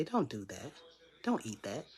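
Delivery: very soft, low inner voice; quiet inner warning. Acted. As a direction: drop low and hushed, a warning from within. Inner voice warns against cake.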